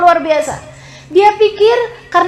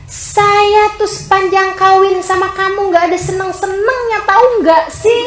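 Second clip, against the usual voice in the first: higher-pitched exasperated complaint; annoyed wife; 5 semitones higher than usual. Acted. Wife fed up with her husband, acted annoyed complaint. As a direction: grumble it in acted irritation, rising at the end. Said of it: "kamu ketika kesel sama suami kamu".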